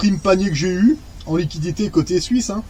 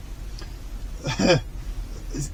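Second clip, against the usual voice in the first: quiet breathy laugh; 4 semitones lower, 10 dB quieter than usual. Real, not acted. Laughing at the pay amount.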